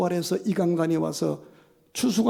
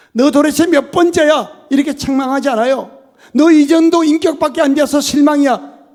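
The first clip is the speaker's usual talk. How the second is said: loud, higher rebuking lines; fervent preaching; 9 semitones higher, 13 dB louder than usual. Acted. Pastor voices the rebuke God does not give, loud. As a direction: hurl the reproach out. Mock scolding within sermon.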